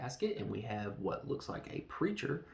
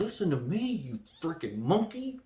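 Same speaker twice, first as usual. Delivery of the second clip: higher, louder angry snap; frustrated outburst; 6 semitones higher, 5 dB louder than usual. Retold or imitated. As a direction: lose your temper at him. Taylor losing patience with Cornelius.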